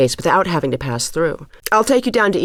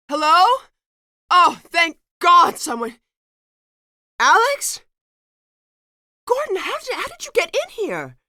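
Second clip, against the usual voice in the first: surprised relief; 12 semitones higher than usual. Acted. Trapped, then finds Gordon.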